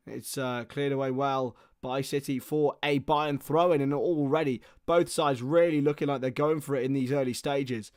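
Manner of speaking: steady live match commentary; play-by-play. Acted. Commentator calling the match for viewers, play-by-play. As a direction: narrate each pass as it happens.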